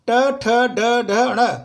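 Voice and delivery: the tongue lifted to the roof for "ट, ठ, ड, ढ, ण"; retroflex letters demo. Shown as a demonstration. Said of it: "उच्चारण का प्रयास करें". (जीभ ऊपर उठाकर "ट, ठ, ड, ढ, ण" ज़ोर से बोलना)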